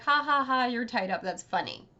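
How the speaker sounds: spoken ha-ha-ha, then amused jeer; mocking Midna. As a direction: tease a tied-up captive. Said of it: "and she's like".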